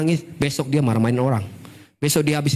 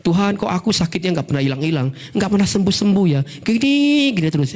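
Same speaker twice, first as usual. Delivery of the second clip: rising, higher plaintive grumble; mock complaint; 7 semitones higher than usual. Sick believer complains to God, mock exasperated plea. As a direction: build the complaint to a high peak, then drop.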